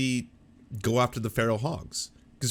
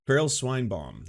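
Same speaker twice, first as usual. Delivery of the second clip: normal talking voice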